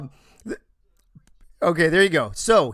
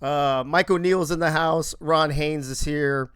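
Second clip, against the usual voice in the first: normal talking voice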